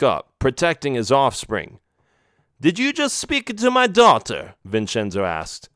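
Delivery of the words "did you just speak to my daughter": higher, pointed Italian mob boss question; Italian don checking up on daughter; Italian accent, suspicious. Acted. Vincenzo confronts boy about daughter, Italian accent. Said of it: "thick Italian accent".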